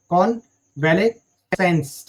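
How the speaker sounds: कॉन्वलेसेंस very slowly in separated chunks; broken into syllables. Shown as a demonstration. Teacher helping students remember the spelling, कॉन्वलेसेंस slowly in pieces. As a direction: draw out कॉन-वले-सेंस, pausing between the parts. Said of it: "आप इसकी spelling याद रखना".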